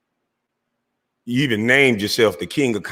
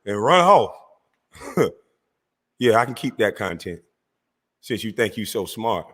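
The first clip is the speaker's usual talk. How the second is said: normal talking voice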